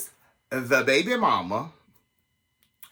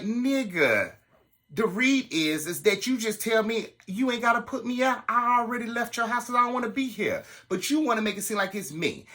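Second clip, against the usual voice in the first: higher, raised retort; heated retold comeback; 10 semitones higher than usual. Telling her date off, heated and higher. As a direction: read him for filth.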